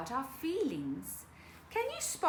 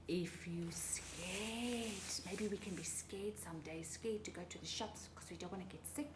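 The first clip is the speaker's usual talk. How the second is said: scared whisper; 3 semitones lower, 9 dB quieter than usual. Pretend. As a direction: whisper as if frightened.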